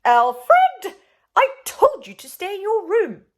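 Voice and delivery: loud, high furious scolding; the Queen scolding her son; furious mother. Acted. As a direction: scold him hard. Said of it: "The Queen was furious".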